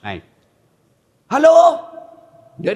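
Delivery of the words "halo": shouting into the phone. Retold or imitated. Haji shouting into wrong end.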